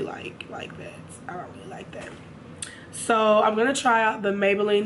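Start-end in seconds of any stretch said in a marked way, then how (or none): 0.0-2.1 soft whispered aside. Real, not acted. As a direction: drop to a whisper. Whispered aside about blotchy makeup.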